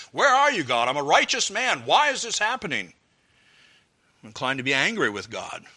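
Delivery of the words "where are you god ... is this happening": Job-like complaint. Retold or imitated. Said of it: "It's like".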